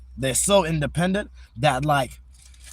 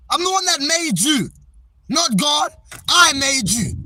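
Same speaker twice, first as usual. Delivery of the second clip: higher-pitched, heated taunting with shouted peaks; heated mocking rant; 7 semitones higher than usual. Real, not acted. Mocking rant at a chat user.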